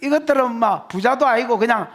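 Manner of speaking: normal talking voice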